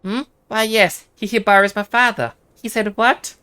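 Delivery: a dutiful daughter hearing of her father; voiced daughter NPC. Voicing a game NPC.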